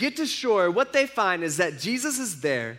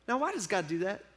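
normal talking voice

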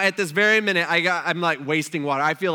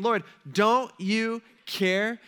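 slow, high drawn-out plea; plaintive appeal; 5 semitones higher, 4 dB louder, much slower than usual. Retold or imitated. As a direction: stretch it into an aggrieved question. Martha's complaint to Jesus, acted.

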